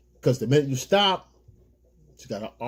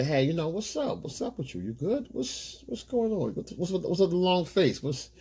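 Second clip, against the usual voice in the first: mock concerned boyfriend; 5 semitones higher, 4 dB quieter than usual. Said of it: "So you go and say".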